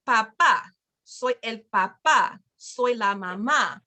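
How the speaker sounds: "Papá" and "mamá" stressed on the last syllable; stress on final syllable. Shown as a demonstration. Teacher showing parents where the accent goes, final-syllable stress. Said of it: "put the accent on the end".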